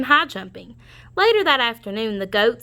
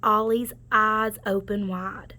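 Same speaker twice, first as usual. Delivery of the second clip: ordinary delivery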